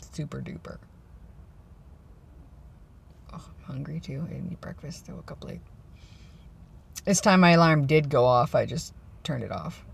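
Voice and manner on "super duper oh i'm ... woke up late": whispering. Real, not acted. Unvoiced under-breath comments.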